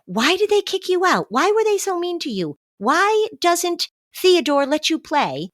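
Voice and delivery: high-pitched, overwrought questioning; mock distressed parent. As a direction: wail each why-question like a hammed-up upset parent. Demonstrates the why questions to avoid.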